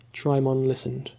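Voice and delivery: ordinary delivery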